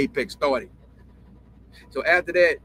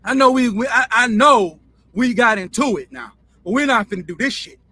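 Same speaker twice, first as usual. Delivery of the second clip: louder and higher, stunned then indignant; heated retort; 5 semitones higher, 6 dB louder than usual. Retold or imitated. Jimmy answering Jerry's slight, heated loud retort. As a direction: bristle back at the insult. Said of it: "So Jerry was like".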